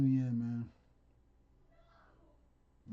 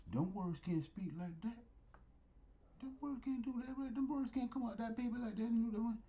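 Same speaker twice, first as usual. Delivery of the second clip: mock doubter in a much higher voice; doubting listener; 9 semitones higher than usual. Preacher mimics a doubter of the Living Bible, high mock voice. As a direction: play the baffled skeptic, pitched way up. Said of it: "you're like".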